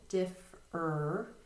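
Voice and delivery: "differ" split into two separate syllables. Shown as a demonstration. Teacher showing what kids hear, syllable by syllable. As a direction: break "differ" into diff, then er. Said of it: "then they'll hear the second syllable".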